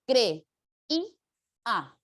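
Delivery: cre, i, a in three separate syllables; syllable split. Shown as a demonstration. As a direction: break creía into cre-i-a with pauses. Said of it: "Hay que separar".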